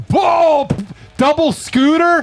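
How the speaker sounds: high, thrilled and slow; light up at the idea; excited. Real, not acted. Excited idea of double scooter.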